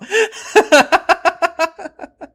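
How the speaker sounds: long, high burst of laughter; high loud laugh. Real, not acted. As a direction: crack up out loud. Laughs at shared confusion.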